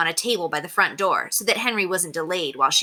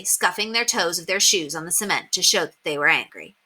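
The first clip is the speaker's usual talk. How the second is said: ordinary delivery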